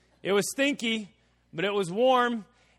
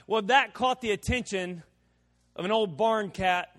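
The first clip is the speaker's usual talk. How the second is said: ordinary talk, nothing marked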